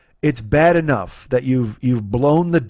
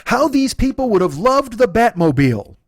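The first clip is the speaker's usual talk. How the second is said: pitched-up scandalised reading of a book line; incredulous reading; 7 semitones higher than usual. Retold or imitated. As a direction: read the quote high, in mock disbelief. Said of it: "Here's the line".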